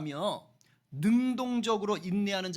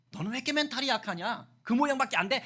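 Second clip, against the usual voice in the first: raised, exasperated telling-off; mock scolding; 5 semitones higher, faster than usual. Preacher acting out berating a faint-hearted person, raised scolding.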